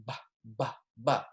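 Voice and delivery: ba with both lips closed, no v; bilabial b. Shown as a demonstration. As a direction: press the lips for ba. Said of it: "It's a bilabial consonant".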